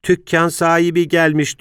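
put-on child calling up. A narrator's reading.

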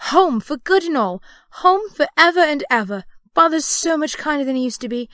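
put-on high, loud, eager child's voice; gleeful little girl. A narrator's reading. Girl telling of going home, brimful of glee. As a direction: gush out the happy news. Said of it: "brimful of glee".

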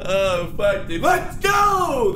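yelling in triumph, pitch jumping up; victory shout. Real, not acted. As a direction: shout it like you just won. Celebrating a win.

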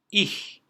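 "Ich" high and loud with a hissed CH. Shown as a demonstration. Teacher giving another C H example, emphatic pronunciation. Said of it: "Diğer bir örnek".

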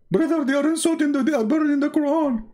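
high-pitched mock outrage; hammed-up shocked protester voice. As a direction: squeal it like a scandalised believer.

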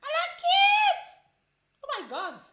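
very high, loud slow squeal; excited shriek. Real, not acted. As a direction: squeal with delight at the hair. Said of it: "Do you like my hair?".

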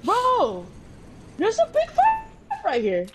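high, urgent shout of alarm; excited callout. Real, not acted. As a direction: call out the fight in a rush. Spots enemies, calls out.

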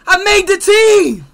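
high, very loud, slow victory yell; triumphant shout. Real, not acted. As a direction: roar it in triumph. Gamer celebrates making team.

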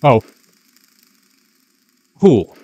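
high, loud startled cry; startled yelp. Real, not acted. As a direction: blurt it out in shock. Startled by the moth.

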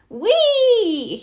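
high joyful whoop as he flies loops; gleeful cry. Acted. Hawk doing loop-the-loops, whooping with glee. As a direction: squeal with delight mid-flight. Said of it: "he cried".

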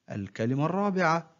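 usual speaking voice

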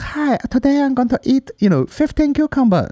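very high, put-on vlogger voice; mock mukbang host. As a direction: imitate an eating-show host in a squeaky-high voice. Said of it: "this other guy's like".

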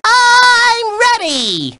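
high, long-stretched whoop; eager battle cry. Acted. Host is set to write the song, eager cry. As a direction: hold the first word high, bursting with eagerness.

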